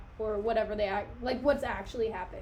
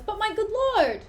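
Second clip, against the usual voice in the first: Rinaldo interrupting; 9 semitones higher, 5 dB louder than usual. Acted. Rinaldo voiced in Hamlet reading.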